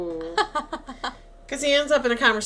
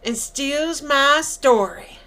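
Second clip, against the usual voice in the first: loud, higher-pitched mock Mississippi drawl; hammed-up Southern accusation, drawn out at the end; southern accent; 6 semitones higher, 10 dB louder than usual. Shooter accusing Mort of stealing his story, southern accent. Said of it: "this southern accent from Mississippi".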